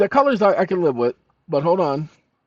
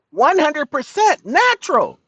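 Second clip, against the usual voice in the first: much higher, slow ad voice; mock label read; 12 semitones higher, 6 dB louder, slower than usual. Mock reading juice label.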